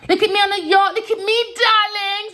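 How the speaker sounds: very high, loud, put-on snooty voice; mocking posh rival. Mocking Genevieve showing off on the yacht, shrill and snooty. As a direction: sneer like a show-off on a yacht.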